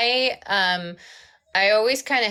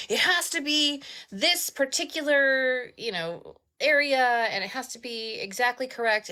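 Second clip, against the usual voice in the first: higher-pitched mock insistence; imitated insistent author; 8 semitones higher than usual. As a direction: play a demanding author laying down requirements.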